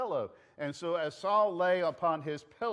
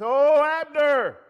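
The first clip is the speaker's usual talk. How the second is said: long, high call across a distance; loud, slow, high-pitched hail; shouting; 11 semitones higher, 13 dB louder, slower than usual. Acted. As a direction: holler the name out, drawn out. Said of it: "David starts to shout".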